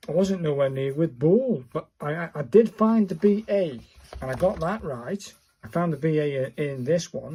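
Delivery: ordinary talk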